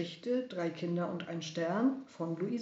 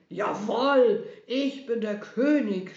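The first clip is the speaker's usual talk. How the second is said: loud, emphatic royal boast; raging tyrant's loud assertion; shouting king; 3 semitones higher, 10 dB louder, slower than usual. A narrator's reading. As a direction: proclaim it at full volume. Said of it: "schrie Herodes".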